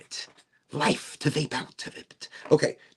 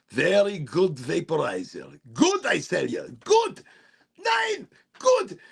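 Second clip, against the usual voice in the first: put-on German accent; 3 semitones higher, slower than usual. Praising German engineering in a mock German accent. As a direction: sell it with a hammed-up German accent. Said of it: "German engineering".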